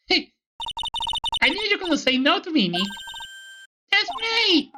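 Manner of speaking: put-on high, bright-eyed teasing girl; teasing sidekick girl, pitched high. Voices Maya in a game.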